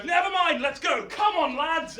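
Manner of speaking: high, loud hurry-up call; rally the troops to flee; urgent rallying shout. Acted. Hercules to his men as cavalry charges, urgent shout.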